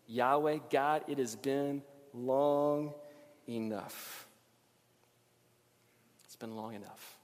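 slow, fading, fed-up plea; weary exasperation. Retold or imitated. Preacher voicing David's frustration, slow and dropping. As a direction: let it sink to a tired breath. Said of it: "what he's really saying".

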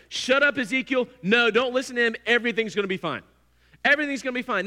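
a loud crowd-leader shouting down the prophet; dismissive shout. Retold or imitated. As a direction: brush off the warning with a loud, bossy yell. False prophets shouting Ezekiel down.

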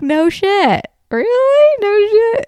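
hammed-up no way, really; mocking her husband's obvious correction; mock sarcastic surprise.

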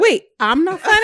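laughing, pitched well up in mock outrage; laughing protest. Real, not acted. Wife teased about not being funny, laughing in mock offence. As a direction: protest through real laughter, playfully hurt.

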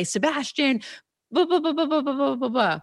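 high-pitched mock scolding, half laughing; hammed-up impatient parent rant, blah blah; mock snapping at child. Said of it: "It's so easy to react and say".

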